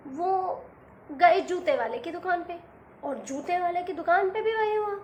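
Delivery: plain reading voice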